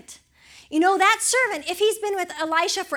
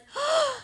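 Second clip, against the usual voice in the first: sharp high gasp of fright; frightened gasp; 10 semitones higher than usual. Retold or imitated. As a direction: gasp as if seeing the enemy. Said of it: "He was like".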